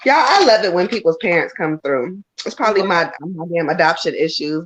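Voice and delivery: ordinary talk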